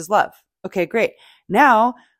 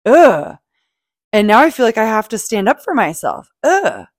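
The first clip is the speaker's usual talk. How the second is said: frustrated groan; 3 semitones higher, 7 dB louder than usual. Retold or imitated. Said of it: "It's more like".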